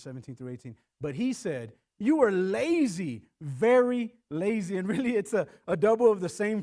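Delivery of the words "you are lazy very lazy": loud, slow scornful accusation; harsh accusation. Retold or imitated. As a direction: spit out each word at the slaves. Pharaoh berating the slaves.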